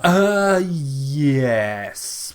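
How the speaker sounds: hesitant, long-held admission; drawn-out hesitant yes. Real, not acted. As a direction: draw it out reluctantly before conceding. Hesitant answer to a doubting question.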